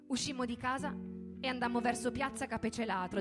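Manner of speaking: ordinary delivery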